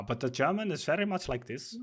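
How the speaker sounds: put-on German accent in English; lightly caricatured German English. Shown as a demonstration. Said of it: "I can do a German accent".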